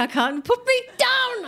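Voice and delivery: very high comic squeal of pain; agonised plea. Retold or imitated. Dancer in agony to helpers, hammed-up squeal. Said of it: "the agony".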